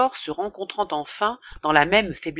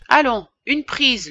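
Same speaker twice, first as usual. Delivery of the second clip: coaxing offer; 7 semitones higher, 5 dB louder than usual. Acted. Priest offering snuff.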